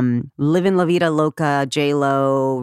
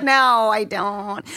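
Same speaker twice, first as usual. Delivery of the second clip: slow, sheepish and owning it; slow, a little higher, embarrassed confession; sheepish admission; 6 semitones higher, much slower than usual. Retold or imitated. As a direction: admit it slowly with a guilty laugh behind it. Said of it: "I was like".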